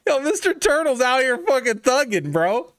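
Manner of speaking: high-pitched, breaking into laughter; cracking up. Real, not acted. Streamer loses it at a commenter's picture, laughing hard. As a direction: squeal it out through real laughter.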